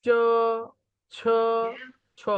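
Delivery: চ, ছ as separate held syllables; Chinese J sound. Shown as a demonstration. Teacher showing how J is pronounced, like চ. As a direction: sound out চ, ছ slowly one by one. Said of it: "জে এর উচ্চারণ হবে চ এর মতো".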